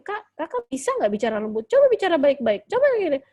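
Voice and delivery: loud, high-pitched parental scolding; rising to a loud, impatient demand. Shown as a demonstration. Mother demanding gentle talk from her child, loud scolding.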